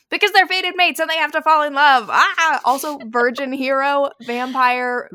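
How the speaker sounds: high, loud, gushing with delight; excited gushing. Real, not acted. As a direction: swoon over the book. Gushing about favorite book.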